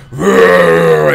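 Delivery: loud imitated beast roar; sudden mock monster roar. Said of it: "that motherfucker's all like".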